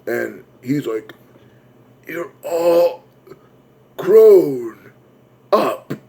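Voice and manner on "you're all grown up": high, halting last words; dying clown. Acted. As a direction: force out each word with long gaps. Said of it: "those were his last words".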